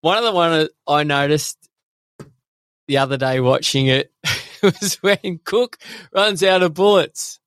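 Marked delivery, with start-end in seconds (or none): none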